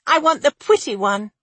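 put-on high little-girl voice; eager child. A narrator's reading. Child picks the princess bed, high and eager. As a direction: pipe up as a small child choosing.

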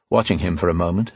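ordinary delivery, nothing marked

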